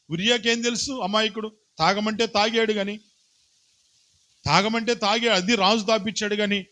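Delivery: high, impassioned pleading preaching; preaching at full force. Acted. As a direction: plead Uriah's innocence to the congregation. Impassioned telling of Uriah's story.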